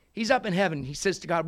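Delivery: ordinary delivery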